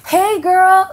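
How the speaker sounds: high, loud friendly call; imitating fans. Strangers spotting her on the street, a high, loud friendly call. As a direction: sing out a bright street greeting.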